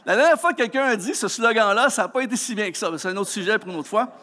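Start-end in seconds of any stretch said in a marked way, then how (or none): none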